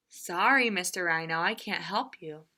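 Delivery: low, whispery sorry frog; a timid little frog apologizing; soft frog voice. Acted. Frog apologizing to the rhino, low soft whispery voice. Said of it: "the frog".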